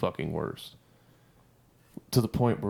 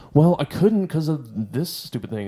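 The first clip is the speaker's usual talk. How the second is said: higher, louder, slowed whine of an excuse; whiny backtalk; 6 semitones higher, 7 dB louder than usual. Retold or imitated. Mocking the bad soldier's excuse to her sergeant. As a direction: whine it like a sulky recruit.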